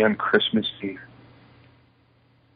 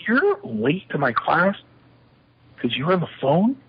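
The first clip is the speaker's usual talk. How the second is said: incredulous professor; 6 semitones higher than usual. Retold or imitated. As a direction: imitate a professor who can't believe the excuse.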